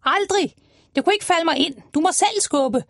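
high, indignant crow voice; high squawky crow, refusing. Acted. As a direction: refuse like a bossy bird. Krage refuses loudly, high voice.

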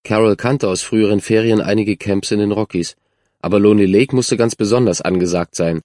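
ordinary delivery, nothing marked

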